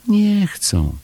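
very high, plaintive voice; dejected little Chomek. A narrator's reading. Chomek bringing Paszczak bad news, high dejected voice. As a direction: complain high and small. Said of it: "Był przygnębiony".

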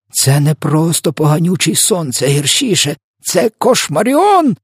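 high cry climbing to a drawn-out shriek; horrified exclamation. A narrator's reading. Giant names the nightmare for Sophia, rising horrified cry. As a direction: build to a high, stretched last word. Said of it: "вигукнув він".

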